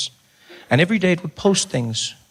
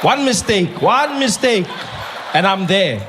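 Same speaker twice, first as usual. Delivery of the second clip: tough colored guy; 4 semitones higher, slower than usual. Retold or imitated. His colored side gripping the knife, ready to brawl. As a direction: talk like you're itching for a fight.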